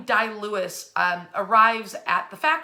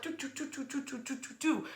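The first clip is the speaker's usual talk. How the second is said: soft, lightly voiced doo-doo hum of a plodding engine; a quiet mock motor tune; humming car noise; 3 semitones higher, 11 dB quieter than usual. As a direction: hum the little car chugging through mud. Imitating the car trucking along.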